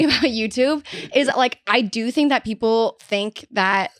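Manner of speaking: normal talking voice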